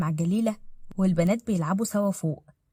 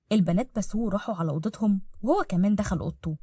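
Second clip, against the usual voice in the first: usual speaking voice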